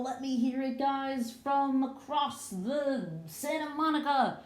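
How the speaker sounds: high, stretched-out call to a distant class; rally faraway students to shout back; calling across town. Pretend.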